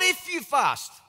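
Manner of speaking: P=A; stressed if. Shown as a demonstration.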